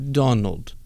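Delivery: put-on a light, quickly dropped Slovenian accent; Melania accent. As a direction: slip briefly into a Slovenian accent, then give up. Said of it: "I'm not gonna keep that accent going".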